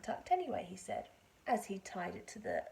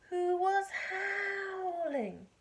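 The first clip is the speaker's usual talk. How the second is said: howling; 9 semitones higher, 6 dB louder, much slower than usual. A narrator's reading. Narrator imitates howl.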